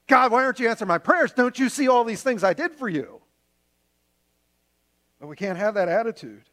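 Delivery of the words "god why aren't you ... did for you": angry complaint to God. Retold or imitated. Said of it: "we get angry at God and go".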